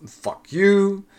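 a rude customer lashing out, mock shouting; angry yell. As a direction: bark it back like a rude old man. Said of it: "he just goes".

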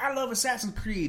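high-pitched mock fangirl gushing; put-on high fangirl voice; crazy gamer girl. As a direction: gush like an obsessed gamer. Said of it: "the crazy ones that are just kinda like".

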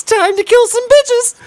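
high squeaky cartoon rat voice; Ricky Rat voice. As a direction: mimic the creepy mascot squeak. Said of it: "of you in that voice saying".

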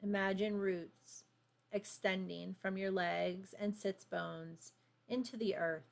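calm, unhurried meditation voice; guided meditation. Acted. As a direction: guide them gently into stillness. Leads a grounding meditation.

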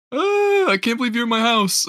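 high, loud starstruck squeal; gushing fan. Retold or imitated. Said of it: "just kept going like".

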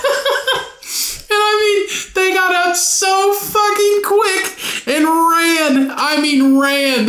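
high squeal through real laughter; helpless laughing. Real, not acted. Storyteller recalling how fast they fled, high laughing squeal. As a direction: squeeze the words out while cracking up. Cracking up at his own story.

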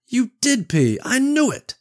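put-on high gleeful child voice; excited kid. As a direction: crow it like a delighted kid. Boy catches mom peeing.

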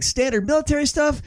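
ordinary talk, nothing marked

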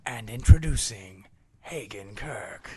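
whispered slow trailer intro; movie trailer voice. Pretend. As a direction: breathe it like a trailer reveal. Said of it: "nineties movie trailer voice".

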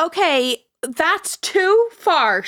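high, loud, slow alarmed protest. Real, not acted. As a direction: recoil in alarm, dragging it out. Horrified at creepy story.

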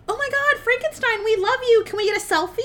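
high-pitched, excited mock squeal of fans; gushing fangirls. Two joggers begging Frankenstein for a selfie, high and gushing.

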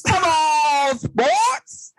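very high shrieked Come on sports; hammed-up fan squeal; squealed mock cheer.

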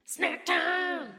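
high, shouted snack call; excited announcement. Acted. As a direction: call it out like a jingle.

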